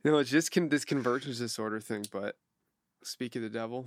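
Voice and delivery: ordinary talk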